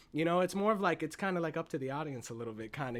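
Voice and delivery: ordinary talk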